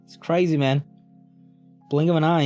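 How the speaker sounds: normal talking voice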